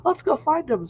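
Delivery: a hint of a dad who forgot his son; startled dad. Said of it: "and he was like".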